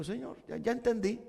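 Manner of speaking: surrendering. Retold or imitated. Preacher surrendering to the Lord, soft and yielding. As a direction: give in, barely above a breath. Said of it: "me rindo y le digo".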